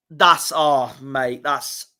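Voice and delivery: usual speaking voice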